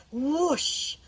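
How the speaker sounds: sudden rising whoosh of a trap; storyteller's sound effect; loud whoosh. A narrator's reading. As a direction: burst out a drawn-out whoosh. Narrator imitates trap springing.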